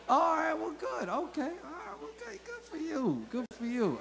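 high, soft, faltering well-wishing; awkward congratulations. Acted. Comic reacting to a couple's dating news, awkward and high. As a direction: squeak out a small, reluctant congratulations.

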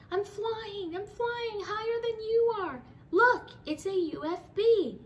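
high, loud gleeful shout; excited kid. Acted. Child floating in the spaceship, bragging with high glee.

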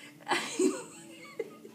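high, squealing laugh; real fit of high giggling; helpless laughter. Real, not acted. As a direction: crack up at your own story. Laughs at torn pants story.

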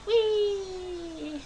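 long, high squeal of fun; playful whee. Real, not acted. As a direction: draw out a high, playful cheer. Playing with the American flag.

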